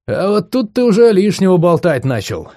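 smirking. A narrator's reading. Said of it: "ухмыльнулся я".

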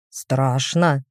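low, hollow voice from beyond the grave; spooky sepulchral voice. A narrator's reading. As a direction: moan it like a ghost trying to scare. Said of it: "пытался напугать меня загробным голосом голем".

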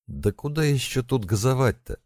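high, irritated retort, not shouted; snapping back. A narrator's reading. Mashka at the wheel, told to step on it, snapping back. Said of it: "огрызнулась Машка".

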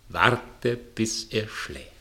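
usual speaking voice

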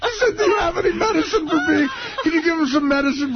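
high-pitched, laughing through the words; can barely get it out for laughing; laughing hard. Real, not acted. Telling the crew about the prank call, laughing helplessly.

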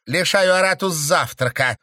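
high, loud curt snap; snapped retort. A narrator's reading. Stranger snaps back at the count, curt retort. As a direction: toss it back sharply. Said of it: "огрызнулась незнакомка".

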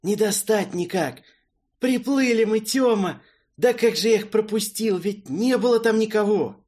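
loud, high, desperate outburst; despairing shout. Acted. Character despairs at missed enemy.

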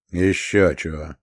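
low, curt grumble; grumbling. A narrator's reading. Pilot dismisses the idea of rehearsing, grumbling. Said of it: "буркнул почтарь".

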